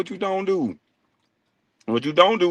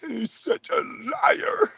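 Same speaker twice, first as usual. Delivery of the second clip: very high, soft, drawn-out fake cry; hammed-up tearful falsetto; mock-hurt whine; 7 dB quieter, much slower than usual. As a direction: imitate a wounded man wailing slowly. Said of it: "sound like he hurt for real".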